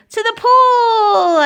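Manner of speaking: very high, loud, drawn-out cry; boy's yell. A narrator's reading. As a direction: yell it high and long like an excited boy. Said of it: "I yelled".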